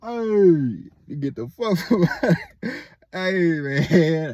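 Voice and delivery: hollering at something in the dark, half-acted; mock calling out. Calling out in retold scare.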